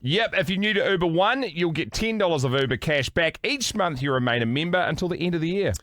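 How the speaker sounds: bright radio ad read. Acted. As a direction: sell it like a radio ad. Host reads an ad.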